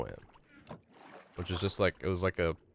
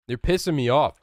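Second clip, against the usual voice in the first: annoyed yell; 5 semitones higher, 7 dB louder than usual. Real, not acted. Yelling at teammate.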